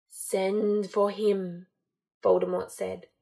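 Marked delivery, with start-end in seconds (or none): none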